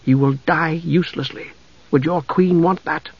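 hushed, earnest persuasion; soft. A narrator's reading. Half-elf dissuades knight from dying, soft. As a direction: reason with him softly. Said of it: "Tanis repeated softly".

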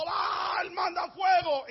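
high, drawn-out cry; retold crowd's desperate call; shouted plea. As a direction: cry out to an absent god, pitched high. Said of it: "Y ellos seguían gritando".

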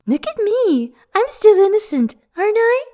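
high, fluttery mock-innocent voice; mock coquette. A narrator's reading. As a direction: play the coy flirt mockingly. Mocking coquette voice.

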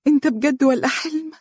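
high, faint, disbelieving voice; barely able to believe he is there; weak and tearful. A narrator's reading. Wife in the clinic chair, weak high voice to her husband. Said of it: "تقول بوهن".

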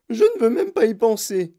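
high, loud cry of horror; howling. A narrator's reading. Mother refuses the thought, howling. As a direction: scream it away. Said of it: "hurla Madame Gloop".

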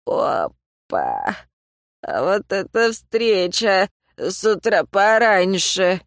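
put-on slow, slurred drunk voice; town drunk. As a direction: play a sloppy drunk greeting. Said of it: "пьяный голос Зака и Нига".

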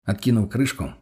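plain reading voice

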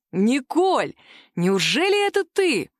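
loud, slow, surprised call; loud exclamation. A narrator's reading. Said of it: "воскликнул он достаточно громко".

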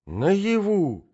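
high, startled exclamation; old prince, high and amazed; astonished cry. A narrator's reading. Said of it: "вскричал князь в удивлении".

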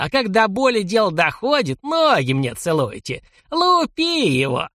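put-on gloating, slow high old geezer; cackling toothless ranting. A narrator's reading. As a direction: taunt like a nasty old man. Old man taunting victim, ranting.

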